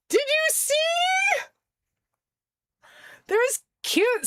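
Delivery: high squealing excitement; shrieking jackpot winner. Real, not acted. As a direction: squeal like you just won big. Excited over new Christmas plates.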